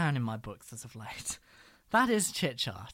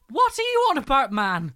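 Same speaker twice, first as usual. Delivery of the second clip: high, loud disbelief; exasperated outburst; 10 semitones higher, 10 dB louder than usual. Real, not acted. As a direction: throw your hands up, much higher and louder. Exasperated outburst at celebrity's remarks.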